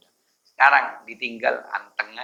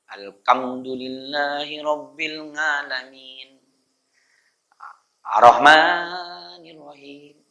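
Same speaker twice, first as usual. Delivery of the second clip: drawn-out chanted recitation; measured Fatihah reading; slow Quranic recitation; slower than usual. Shown as a demonstration. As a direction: recite slowly as an imam would.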